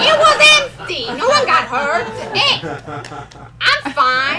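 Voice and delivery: put-on indignant, high and loud self-defence with an Italian accent; loud protest. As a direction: protest it at full volume, brushing off the crash. Defends her crash to the audience.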